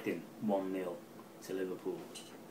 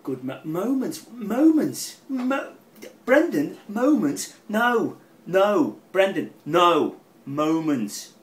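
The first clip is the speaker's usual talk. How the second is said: shouts moments high and loud, pleading Brendan no; a fan wailing at Brendan Rodgers, pitched-up loud mock anguish; 9 semitones higher, 12 dB louder, slower than usual. Wail at Brendan in high, loud mock despair.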